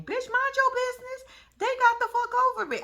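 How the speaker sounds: high, loud call-out; exasperated outburst. Real, not acted. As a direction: scold her like she can hear you. Yelling at the messy mom.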